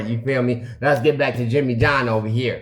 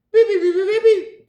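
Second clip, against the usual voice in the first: loud, very high beep beep; button beeps; 4 dB louder than usual. Retold or imitated. Working the ATM keypad, loud high beeps. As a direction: beep out the keypad presses loudly.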